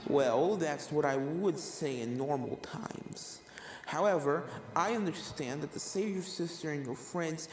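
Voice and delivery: a creepy calm killer ghost toying with her prey; ghost Jack. Acted. Ghost killer explains herself to the filly, softly menacing.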